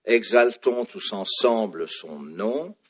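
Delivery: "Exaltons tous ensemble son nom" loud and slow, with strong beats on phrase-final syllables; stressed French accents. Shown as a demonstration. Teacher showing where accents fall, "Exaltons tous ensemble son nom" firmly stressed. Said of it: "majuscule les accents français".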